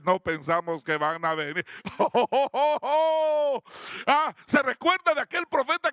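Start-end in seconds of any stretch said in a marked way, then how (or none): 1.7-4.0 loud, high drawn-out whoop; exultant "ho-ho-ho" whoop. Real, not acted. Preacher rejoicing in God's miracles before the congregation, exultant whoop. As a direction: let out a long exultant "oh-oh-oh".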